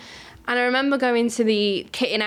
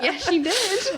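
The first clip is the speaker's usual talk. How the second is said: a higher, laughing boast as the proud dad; dad bursting with pride, voice breaking into laughter; 10 semitones higher, much slower than usual. Retold or imitated. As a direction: brag about his daughter through a giggle. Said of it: "And my dad's like".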